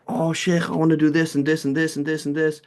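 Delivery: put-on a keen young student gushing his wish list; eager student. Eager student voiced to sheikh.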